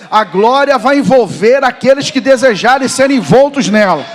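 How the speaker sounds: loud preaching climbing to a high cry; full-force preaching. Real, not acted. Preacher calls the willing to be sent, rising shout.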